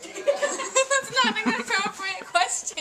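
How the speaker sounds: slow, high words half-laughed; real laughing objection; laughing protest. Real, not acted. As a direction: protest while cracking up. Laughing protest at a silly question.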